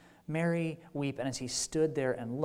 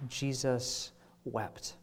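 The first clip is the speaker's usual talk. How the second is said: solemn slow reading; 3 semitones lower, 6 dB quieter, much slower than usual. Shown as a demonstration. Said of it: "When you hear the phrase, "Jesus wept".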